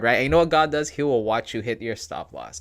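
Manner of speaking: usual speaking voice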